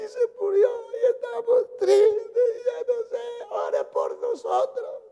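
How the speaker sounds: a tearful neighbor begging the pastor for prayer; retold crying neighbor. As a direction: voice the grieving neighbor breaking into tears. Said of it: "estaba llorando".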